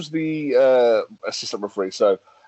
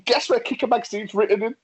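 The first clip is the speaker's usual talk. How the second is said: normal talking voice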